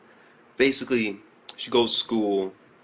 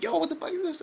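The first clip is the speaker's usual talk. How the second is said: very high-pitched disbelief; bewildered reaction; faster than usual. Retold or imitated. Reacting to the suit's origin, bewildered. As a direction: retell it high and baffled.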